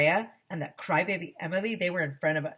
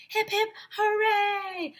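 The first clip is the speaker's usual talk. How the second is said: high, slow hip hip hooray; gleeful cheer; crowd yelling; slower than usual. Acted. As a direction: chant it as a crowd. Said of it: "everyone yelled".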